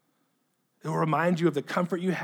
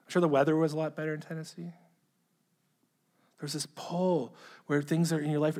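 ordinary talk, nothing marked

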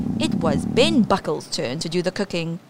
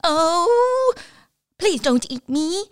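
pleading spider; 10 semitones higher, slower than usual. Acted. Spider begs troll.

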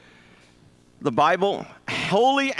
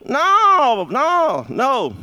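emphatic no; 9 semitones higher, 5 dB louder, much slower than usual. Real, not acted. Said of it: "Bible said that's wrong".

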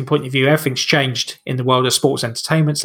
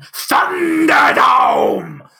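high, slow booming shout of Thunderdome; announcer shout; 10 semitones higher, much slower than usual. Acted. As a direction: dig deep and bellow it like an announcer. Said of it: "it has to be done this way ... dig deep down".

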